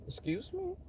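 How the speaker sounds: soft, rising, taken-aback question; mock polite. Questioning a coworker's tone, softly offended. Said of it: "So I'd be like".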